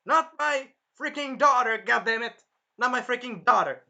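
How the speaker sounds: loud, high overprotective dad yell; protective yell. Acted. Naofumi protecting Raphtalia, loud fatherly yell. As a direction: bellow it like a furious father.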